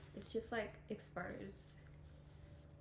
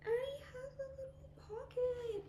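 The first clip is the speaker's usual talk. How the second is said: high whisper. Real, not acted. Cooing over a cute detail.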